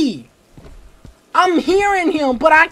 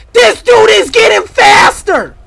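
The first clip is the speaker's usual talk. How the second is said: loud, high, panicked yell; alarmed shout; 6 semitones higher, 8 dB louder than usual. Real, not acted. Pursuer speeds up in game.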